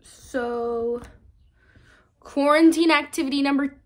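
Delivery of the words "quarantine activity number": high, loud segment intro; loud announcement. Real, not acted. Vlogger introducing a quarantine activity, loudly announced. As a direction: belt out the title like an announcer.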